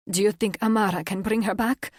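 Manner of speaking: grieving mate asking if she can return; voice cracking. Acted. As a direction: hold back tears as you ask. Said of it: "His voice cracked with raw emotion".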